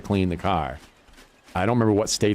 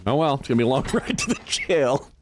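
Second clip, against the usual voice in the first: laughing; 4 semitones higher than usual. Retold or imitated. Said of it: "the pooping comment killed me".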